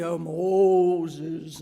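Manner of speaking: low booming movie God, lightly done. Retold or imitated. God calling out to Moses from the bush, booming. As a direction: ham up the film God calling. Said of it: "in the movie".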